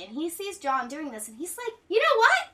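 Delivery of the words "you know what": high, loud mock epiphany; imitating someone suddenly all in; fired-up resolve. Helmholtz's sudden support acted out.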